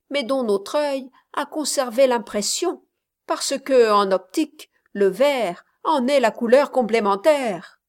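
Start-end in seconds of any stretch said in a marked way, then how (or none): none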